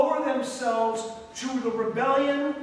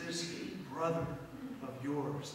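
"This brother of yours" lower and softer; tender phrase; 6 semitones lower, 9 dB quieter than usual. Shown as a demonstration. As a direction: gently stress "brother". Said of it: "You see the difference?".

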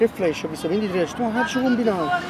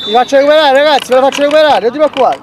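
loud; 6 semitones higher, 12 dB louder, faster than usual. Real, not acted. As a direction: call it out forcefully. Promising to recover, loudly. (promessa urlata)

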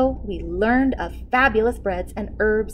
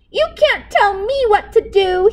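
high, loud bratty shout; shouting king; 12 semitones higher, 7 dB louder than usual. A narrator's reading. King shouts back at the cook, high and loud. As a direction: yell it like a spoilt child. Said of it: "he shouted".

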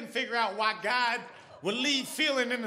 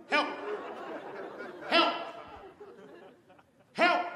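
calling for help; 8 semitones higher, slower than usual. Retold or imitated. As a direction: yell for help, building up. Pressing help button, calling out.

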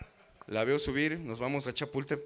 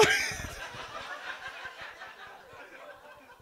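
high breathy laugh; 4 dB quieter than usual. Pretend. Mock laugh after song.

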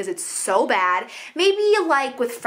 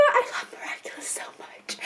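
breathy whisper, hushed and adoring; whispering fangirl confession; whispered gush; 11 dB quieter than usual. Real, not acted. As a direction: whisper it like a secret love. Gushing confession about show.

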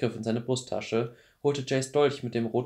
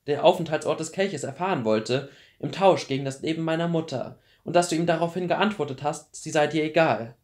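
higher, louder, insistent list of charges; mounting accusation; 5 semitones higher, 6 dB louder than usual. A narrator's reading. Clary confronts Luke with all she knows, accusing. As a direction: confront him point by point, pressing harder.

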